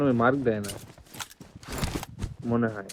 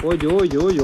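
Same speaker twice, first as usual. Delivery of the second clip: excited callout; 5 semitones higher, 8 dB louder than usual. Real, not acted. Spotting enemy in fight.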